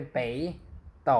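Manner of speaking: 北 rising like a second tone, then 斗 in third tone; third-tone sandhi. Shown as a demonstration. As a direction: model 北斗 with the first syllable turned to tone two. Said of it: "เสียงสามอันแรกจะกลายเป็นเสียงสอง".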